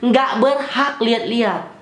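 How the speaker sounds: high, loud and slow mock rebuke; scolding cop. Cop scolding the driver who asked, slow mock rebuke. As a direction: drag it out like a bossy officer. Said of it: "ini kata polisinya".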